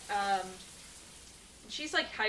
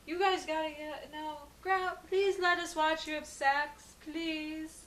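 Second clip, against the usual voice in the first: put-on high, pleading child voice, drawn out; begging little kids; 8 semitones higher, 3 dB louder, slower than usual. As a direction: whine like kids begging for a cookie. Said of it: "like little kids asking for a cookie".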